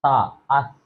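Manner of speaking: सा and अस with tongue tip at the teeth root; correct sa. Shown as a demonstration. Teacher shows students the tongue placement. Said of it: "जुबान की नोक ऊपर वाले दांतों की जड़ में".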